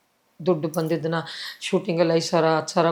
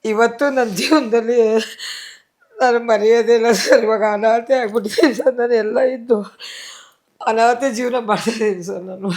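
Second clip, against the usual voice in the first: grieving widow, voice raised and breaking; tearful; 3 semitones higher, 4 dB louder than usual. Real, not acted. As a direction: voice rising with grief, close to tears. Widow recalls husband's death.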